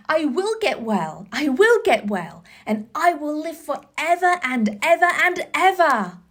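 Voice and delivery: joyful vow. Acted.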